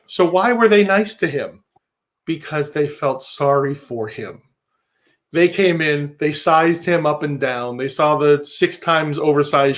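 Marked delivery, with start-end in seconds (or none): none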